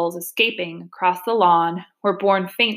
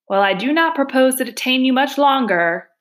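higher-pitched after-dinner speaker; banquet speechmaker; 5 semitones higher, 4 dB louder than usual. Acted. Weasel addressing the cheering banquet, high speechmaker voice. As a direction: address a banquet hall in a raised character voice.

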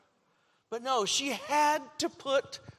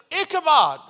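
louder, firm insistence on Ichabod; 5 semitones higher, 10 dB louder than usual. Retold or imitated. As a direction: slam the name down with finality. Mother insisting on the name.

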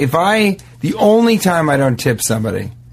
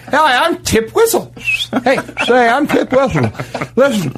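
put-on old-timey salesman; 11 semitones higher than usual. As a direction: sell it like a wagon medicine man. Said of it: "And he goes".

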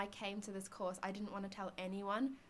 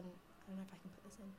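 whispering; 13 dB quieter, faster than usual. Real, not acted. Aside about podcast content.

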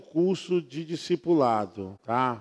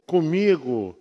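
ordinary talk

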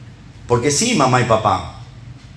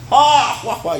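high, loud hammed-up guffaw "juá, juá"; mock crowd laugh; 11 semitones higher, 4 dB louder, slower than usual. Preacher mimics the congregation laughing at an impression. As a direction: burst out like the whole room laughing. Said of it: "Y todos se ríen".

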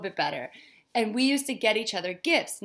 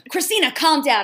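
a light, raised take on a scolding father; dad telling her to calm down; 5 semitones higher, 8 dB louder than usual. Retold or imitated. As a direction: mimic a dad hushing his daughter, a bit louder. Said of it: "my dad was like".

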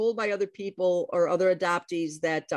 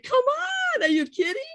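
incredulous protest; faster than usual. Retold or imitated. Adoptee retelling her reaction to the sister's absence, incredulous. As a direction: protest as if you can't believe it. Acted reaction to bad news.

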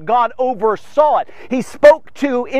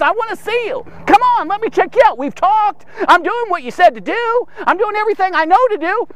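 high-pitched, insistent begging; pleading petition; 9 semitones higher than usual. Acted. Moses petitioning God in the desert, high and pleading. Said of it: "He's petitioning God".

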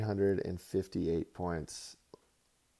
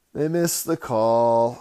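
louder, slower, hammering it home; emphatic loud repeat; 2 semitones higher, 10 dB louder, slower than usual. Shown as a demonstration. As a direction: pound the point with a raised voice.

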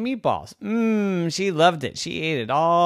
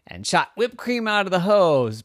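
ordinary delivery

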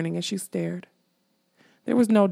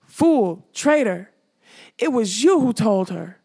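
loud, biting angry outburst; savage fury; 3 semitones higher, 4 dB louder, slower than usual. Acted. King Haggard raging at the skull that betrayed him, furious. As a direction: lash out at the traitor. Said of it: "the king's voice wrestled savagely".

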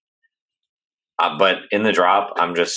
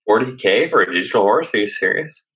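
higher-pitched, disbelieving question; incredulous; 6 semitones higher, 4 dB louder than usual. Real, not acted. Co-host balking at the digital horse's price, incredulous. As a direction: balk at it in real disbelief.

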